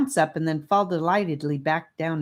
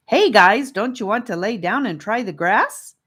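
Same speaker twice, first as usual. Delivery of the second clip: louder, higher call up to them; 5 semitones higher, 7 dB louder than usual. Acted. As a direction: call up to the others from the grass. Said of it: "asked Gabe from below them".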